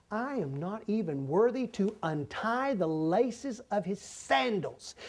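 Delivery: slow, soft and lowly; bow your voice down, unworthy and quiet; humble hush. Retold or imitated. Preacher voicing John the Baptist's lowly confession, slow and hushed.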